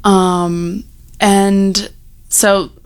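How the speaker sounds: ordinary delivery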